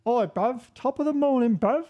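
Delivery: a cheeky Londoner ordering chip butty; overdone British accent with a rising "bruv"; mock British accent. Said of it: "Maybe it's bruv".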